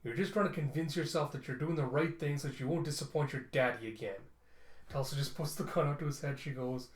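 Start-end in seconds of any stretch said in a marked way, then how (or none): none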